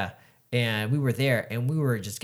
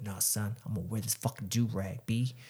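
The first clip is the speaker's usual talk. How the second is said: East Coast slang voice; 2 semitones lower, 8 dB quieter than usual. Retold or imitated. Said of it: "Super Dominican dude".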